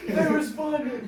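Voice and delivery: high, slow amazed echo; disbelieving laugh. Real, not acted.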